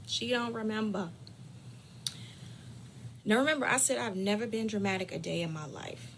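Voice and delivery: ordinary talk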